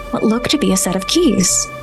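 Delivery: usual speaking voice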